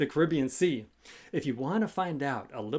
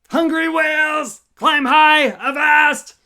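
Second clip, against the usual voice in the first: much higher, loud sea captain's cry; captain's shout; 13 semitones higher, 12 dB louder than usual. Acted. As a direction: bellow the order across the waves.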